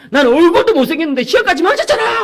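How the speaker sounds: a son crying that he's ugly and failed; sobbing teen son. Retold or imitated. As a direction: wail it like a hurt teenager. Son's tearful complaint.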